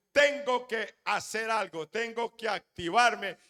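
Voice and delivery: voz alta y aguda, con determinación; proclámalo con fuerza, resuelta a actuar; decidida. Acted. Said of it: "ella dijo". (con determinación, alzando la voz)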